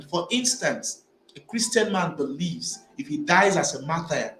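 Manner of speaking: ordinary talk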